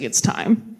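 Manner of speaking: low, hushed ominous announcement; solemn parent. Retold or imitated. Comic asking audience how parents did it, in a low grave parent voice. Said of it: "or were your parents just like".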